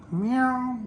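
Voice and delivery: high, soft imitated meow; soft cat meow. Imitating a cat being quiet, with a soft high meow.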